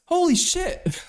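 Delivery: very high, squealed disbelief; shocked exclamation. Real, not acted. Streamer reacting to Trump's birth year, high-pitched shock. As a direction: squeal it in amazement.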